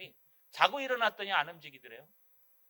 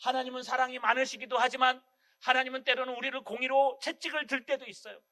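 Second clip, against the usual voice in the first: ordinary talk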